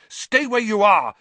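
high, loud command; shouted. A narrator's reading. Harry runs at Pettigrew, shouting. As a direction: shout it to halt someone fleeing. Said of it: "Harry shouted".

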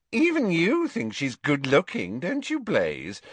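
high-pitched schoolgirl voice; Pansy's needling. A narrator's reading.

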